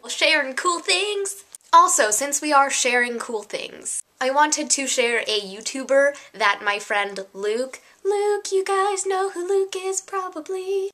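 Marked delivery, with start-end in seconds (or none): 0.1-1.5 high, drawn-out mock title call; segment announcement. YouTuber announcing a sharing segment, playful high call. 8.1-10.9 much higher put-on voice; playful aside. Vlogger to viewers about a friend, high playful aside.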